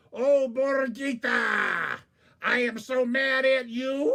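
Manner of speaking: loud, high, slow wailing howl; mad howl. Acted. Coyote howling at Borgita, loud and slow. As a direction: wail your anger to the moon. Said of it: "he's still angry".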